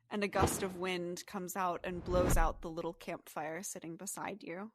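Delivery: ordinary talk, nothing marked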